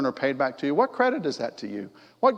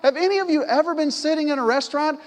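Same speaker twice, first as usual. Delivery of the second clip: higher, louder, lively delivery; excited preaching; 12 semitones higher, 4 dB louder than usual. Real, not acted. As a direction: lift the congregation with joy. Preaching with rising energy.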